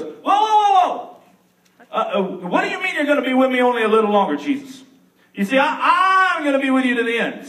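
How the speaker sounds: high, loud, frantic outburst; panicked Peter. Acted. Peter protesting to Jesus, high and panicked. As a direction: Peter blurting out in alarm.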